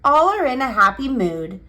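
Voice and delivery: usual speaking voice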